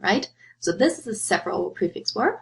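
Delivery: ordinary talk, nothing marked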